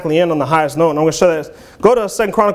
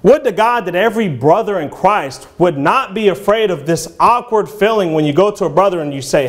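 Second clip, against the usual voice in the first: ordinary delivery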